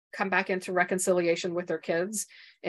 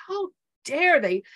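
high, loud and slow: how dare they; retold rage, pitched high and loud; indignant outrage; 9 semitones higher, 5 dB louder than usual. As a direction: relive the fury in a sharp outburst. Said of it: "And I raged".

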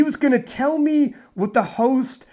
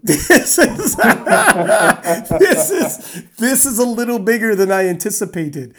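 laughing through the words in disbelief; nervous laughing; 5 dB louder than usual. Retold or imitated. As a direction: giggle it out, overwhelmed by how big it is. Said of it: "I'm like".

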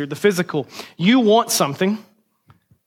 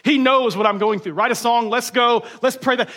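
higher-pitched, sloganeering enthusiasm; mock hype; 5 semitones higher than usual. Preacher parodies selling a spiritual phrase, mock hype.

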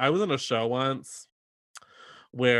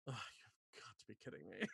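muttered annoyance; 3 semitones lower, 25 dB quieter than usual. Retold or imitated. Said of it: "Ivy go".

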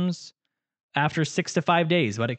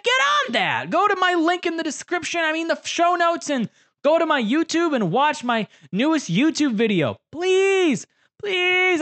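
high, pleading whine; whiny begging voice; 12 semitones higher than usual. Pretend. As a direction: beg like a needy child. Begging listeners to watch video.

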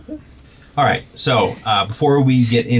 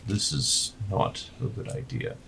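soft, subdued objection; quiet conferring; 11 dB quieter than usual. Acted. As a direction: say it softly, as if not to be overheard. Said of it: "speaking quietly to each other".

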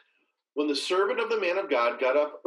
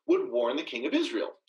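usual speaking voice

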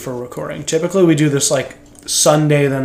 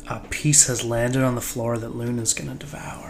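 whisper; 4 semitones lower, 9 dB quieter than usual. Pretend. Whispering about dog and pizza.

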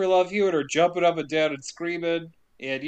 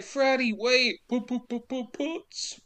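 higher, softer, dying away; mock fading plea; 7 semitones higher, 4 dB quieter, slower than usual. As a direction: let it fade out pitifully. Mock Jennifer pleading, fading.

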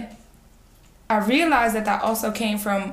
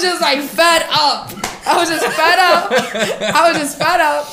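louder and higher, laughing through it; laughing fed up; 5 semitones higher, 6 dB louder than usual. Real, not acted. Telling friends how she snapped at her sister, laughing. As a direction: laugh out the frustration.

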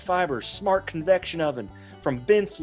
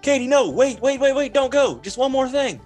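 loud, high mock-frantic pleading; urgent calling out; 11 semitones higher, 6 dB louder than usual. Dad stopping daughter leaving upstairs, frantic. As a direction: call after her, begging her to wait.

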